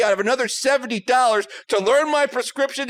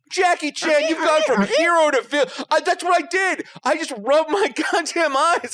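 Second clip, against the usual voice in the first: high-pitched mock indignation; indignant; 6 semitones higher than usual. Patient telling Jackie Chan he went from hero to villain, indignant. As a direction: accuse him like a betrayed fan, voice pitched up. Retold confrontation with the doctor.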